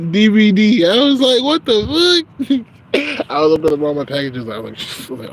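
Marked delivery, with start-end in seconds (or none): 0.1-3.3 high, loud, laughing through words; crying with laughter; squeaky laughing disbelief. Real, not acted.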